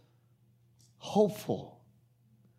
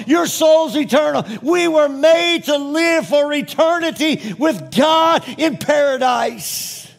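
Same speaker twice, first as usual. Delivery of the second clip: high, loud, ringing proclamation; full-force preaching; 10 semitones higher, 8 dB louder than usual. Real, not acted. Preacher proclaims eternal hope to congregation, full-force preaching. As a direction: preach it at the top of your voice.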